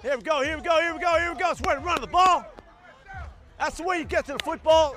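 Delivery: high, loud hype chant; psyching myself up. Real, not acted. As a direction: pump up the team. Coach firing up practice.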